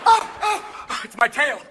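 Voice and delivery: high, loud silly dog; hammed-up doggy surprise; startled dog. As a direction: yelp in sudden discovery. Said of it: "Poor dogs".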